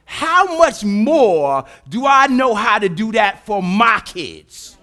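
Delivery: loud, slow, commanding voice of God; God's booming voice. Acted. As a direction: thunder it down as God. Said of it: "And God said".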